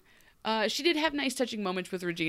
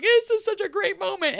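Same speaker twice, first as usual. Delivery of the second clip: high, excited gush; 11 semitones higher, 5 dB louder than usual. Retold or imitated. Describing the touching scene, high and gushing. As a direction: gush over the moment. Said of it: "it was just like".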